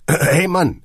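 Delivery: said through a cough, loud. A narrator's reading. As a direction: cough out the words. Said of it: "erwiderte Hagen hustend".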